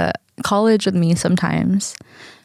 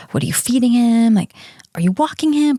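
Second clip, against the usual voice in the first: higher-pitched fretful questioning; helicopter mom; 5 semitones higher than usual. Retold or imitated. Dog owner phones her parents to check up, nagging. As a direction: pester them like an overanxious mom. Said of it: "like this helicopter mom".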